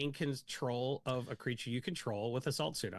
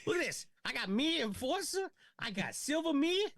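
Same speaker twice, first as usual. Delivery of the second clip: much higher-pitched Boston mimicry; imitated Boston accent; 12 semitones higher than usual. Player retelling a Boston opponent showing his cards, imitated Boston accent. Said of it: "He kept saying me".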